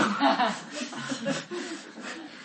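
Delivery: a burst of high laughter at Peter; high mocking laugh. 牧师想象自己嘲笑彼得，高声大笑. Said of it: "我一定会说".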